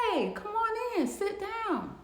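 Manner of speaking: a boss putting on a big welcoming smile; smiling boss. Retold or imitated. Boss greets him smiling.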